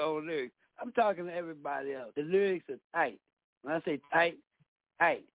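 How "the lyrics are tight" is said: ordinary talk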